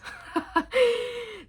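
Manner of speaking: a burst of high, giddy laughter; high delighted laugh. Real, not acted. Reader delighted by the love card, high giddy laugh.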